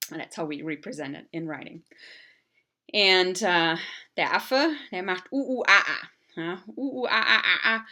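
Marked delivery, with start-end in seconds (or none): 5.3-7.9 loud laughing ooh ooh aah aah; laughing monkey calls. Shown as a demonstration. Teacher doing monkey calls and cracking up. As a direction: hoot like a monkey, giggling. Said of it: "can be extended a sounds".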